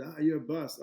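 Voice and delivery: a sneering fan, lightly voiced; mock heckler. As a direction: imitate someone jeering at him. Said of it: "somebody comes to me and he said".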